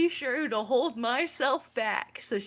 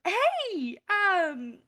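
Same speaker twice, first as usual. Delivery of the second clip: put-on higher, girly cheerleader greeting; 7 semitones higher, much slower than usual. Cheerleader Vicky greets Jojo in a higher girly voice. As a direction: pitch it up to greet the boy from class. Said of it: "she walks up to him and goes".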